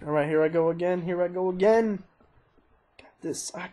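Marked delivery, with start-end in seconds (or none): 3.0-3.5 breathy hush, psyching himself up; whispered pep talk. Real, not acted. Gamer whispers to himself before a run, hushed.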